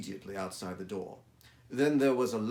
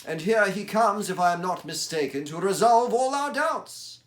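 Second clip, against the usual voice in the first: higher, louder brisk detective; Holmes voice; 10 semitones higher, 12 dB louder than usual. Acted. Holmes tells Watson the client arrives, brisk and keen. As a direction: announce the arrival like a keen sleuth.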